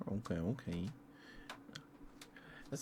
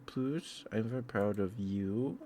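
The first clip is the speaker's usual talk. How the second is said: ordinary talk, nothing marked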